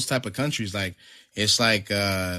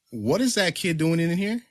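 higher-pitched mock objection; retold disapproving aunt; 7 semitones higher than usual.

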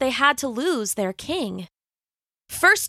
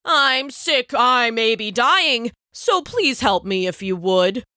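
fake sick lion. Lion fakes being sick.

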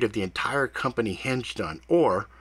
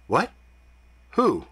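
startled; 8 semitones higher, 4 dB louder than usual. A narrator's reading. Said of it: "his tone shifting slightly".

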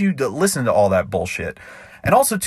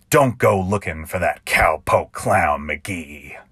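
ballad narration; 4 semitones lower than usual. A narrator's reading. Narrator reading his cowboy ballad to listeners, rhythmic and sly. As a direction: spin it like a campfire tall tale.

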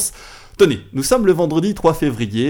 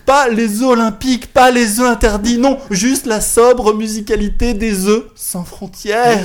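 grand tragic stage delivery; 6 semitones higher, 4 dB louder than usual. Retold or imitated. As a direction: recite the verse like a tragedian. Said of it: "leur déclame ces quelques vers".

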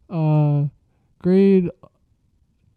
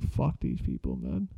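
low grumble; 12 dB quieter than usual. Retold or imitated. Signing papers, complaining to himself.

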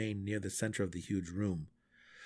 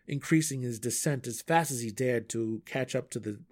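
ordinary delivery

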